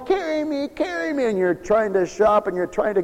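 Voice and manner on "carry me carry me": put-on whining child. Parent describing a bawling child at the grocery store, high whine. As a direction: whimper it like a clingy toddler.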